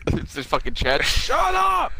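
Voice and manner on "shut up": high mock shout; mock yell. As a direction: screech it like a fed-up cop. Voicing Chad yelling.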